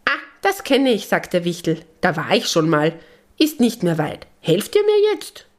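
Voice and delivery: cheerful imp who got himself stuck; little gnome, pleading. Acted. Gnome stuck in the statue begs Lisabella and the animals to help.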